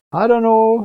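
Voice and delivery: lightly imitated Southern preacher accent. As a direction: impersonate a Southern revival preacher saying it. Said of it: "you gotta preach with a Southern accent".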